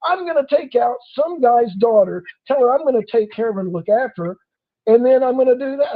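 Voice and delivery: incredulous retort. Retold or imitated. His own shocked retort retold.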